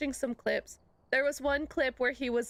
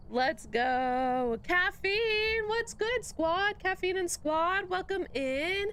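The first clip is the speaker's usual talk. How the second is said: hyped stream greeting; 6 semitones higher, much slower than usual. Real, not acted. Greeting viewers joining the stream.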